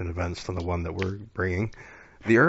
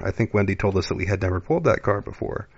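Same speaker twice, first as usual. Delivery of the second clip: ordinary talk, nothing marked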